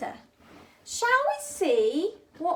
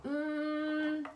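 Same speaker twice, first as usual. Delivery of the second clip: normal talking voice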